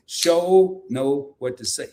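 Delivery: slow, careful drawled "sure know what to say". Shown as a demonstration. Host slowly copying Keisha's Southern saying. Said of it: "If you talk like me".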